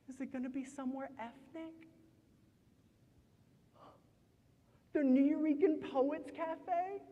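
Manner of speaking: high, hesitant questions with long pauses; wary questioning. Acted. Wary partner asks in poem.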